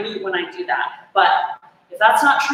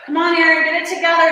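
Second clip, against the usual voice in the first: yelling at herself; 6 semitones higher, faster than usual. Real, not acted. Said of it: "just yell at yourself".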